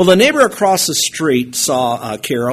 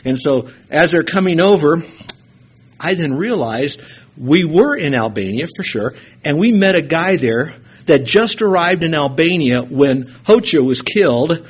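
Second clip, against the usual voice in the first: ordinary delivery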